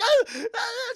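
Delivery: high, loud repeated screams; hammed-up freak-out shrieks; mock panicked screaming. Burglar freaking out over the spider, mock screaming. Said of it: "Starts screaming".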